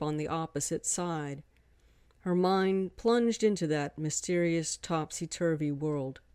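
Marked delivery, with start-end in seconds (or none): none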